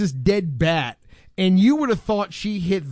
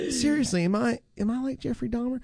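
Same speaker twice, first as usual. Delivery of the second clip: unsure question; 5 semitones higher, 5 dB quieter than usual. Retold or imitated. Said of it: "a moment of clarity".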